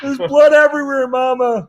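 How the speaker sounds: put-on high, loud whiny kid calling for mama, laughing; hammed-up hurt child, half laughing; mock crying child. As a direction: play a little kid wailing for his mother. Playing kid cut on tin.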